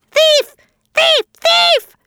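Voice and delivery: very high, piercing screech; angry scream. A narrator's reading. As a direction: shriek each word in a fury. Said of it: "screamed Sammy, hopping up and down. He was so angry".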